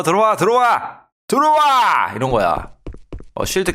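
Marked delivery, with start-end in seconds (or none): 0.0-2.1 high, loud call daring the boss in; loud dare. Real, not acted. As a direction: shout at the boss to come at you. 보스에게 들어오라 외침. (보스에게 크게 들어오라고 외침)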